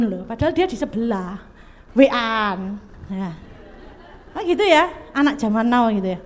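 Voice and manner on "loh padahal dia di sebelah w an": usual speaking voice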